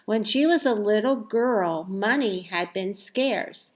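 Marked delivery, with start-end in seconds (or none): none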